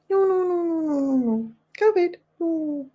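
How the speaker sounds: rapid high-pitched no's; high playful denial. Real, not acted. Denying the chat's guess, high and teasing.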